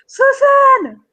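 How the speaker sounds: called high and loud across the steppe; calling out. Acted. Mega calls to the smith across the steppe, shouting. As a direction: yell the name as if across the steppe.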